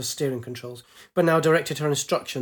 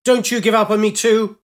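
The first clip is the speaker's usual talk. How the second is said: loud, high pleading yell; desperate shout; 7 semitones higher, 9 dB louder than usual. A narrator's reading. Pilot shouting at her failing sphere, desperate. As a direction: beg and scold at once. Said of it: "She shouted at her sphere".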